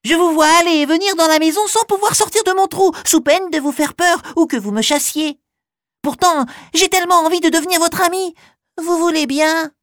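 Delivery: high, fast squeaky mouse; little mouse voice. Acted. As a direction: chatter it like a tiny mouse. Mouse asks for friendship.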